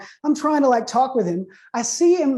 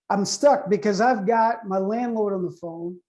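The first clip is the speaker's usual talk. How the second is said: ordinary delivery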